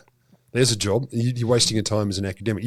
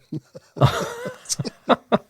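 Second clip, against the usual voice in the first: high squealing burst of laughter. Real, not acted. At the spider robot idea, high squealing laugh.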